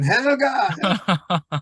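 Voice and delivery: high-pitched fake Swedish call; mock Swedish accent. Calling the backpacker girl by a false name, mock Swedish accent. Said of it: "I put on my best Swedish accent".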